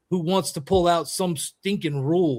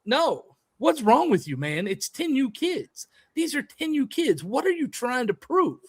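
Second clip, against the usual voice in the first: much higher, exasperated imitated protest; retold exasperated argument; 9 semitones higher than usual. Coach replays arguing over ten U kids, high and exasperated. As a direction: plead incredulously in a high, heated retelling. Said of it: "I was like".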